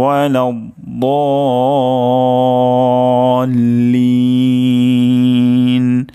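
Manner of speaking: drawn-out Quranic recitation. Shown as a demonstration. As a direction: chant the full verse, stretching the long vowels. Full verse recitation.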